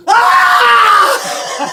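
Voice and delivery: high, full-throated scream. Retold or imitated. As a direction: let out a long leaping scream. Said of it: "I scream".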